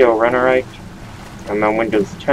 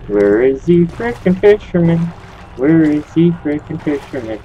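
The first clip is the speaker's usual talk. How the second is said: calling out; 6 semitones higher than usual. Real, not acted. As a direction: shout for the hidden ghost. Calling out for the ghost.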